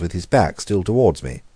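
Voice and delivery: usual speaking voice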